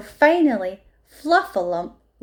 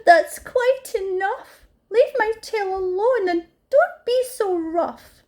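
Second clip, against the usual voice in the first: crying out; 11 semitones higher, 3 dB louder than usual. A narrator's reading. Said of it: "Poor Fluffalump jumped crying".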